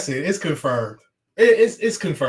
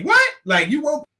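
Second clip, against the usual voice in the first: incredulous; 9 semitones higher, 3 dB louder than usual. Retold or imitated. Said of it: "I wanted to say".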